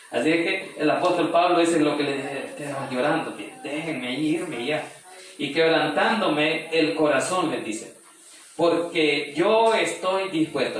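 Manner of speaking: slow, high, loud pulpit declaration; preacher proclaiming to the congregation; fervent preaching. Acted. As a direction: declare Paul's resolve with full conviction. Preacher proclaims Paul's resolve.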